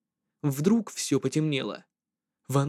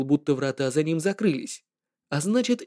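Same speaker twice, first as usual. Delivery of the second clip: ordinary delivery, nothing marked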